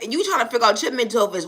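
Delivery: ordinary talk, nothing marked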